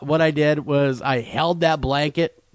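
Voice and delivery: usual speaking voice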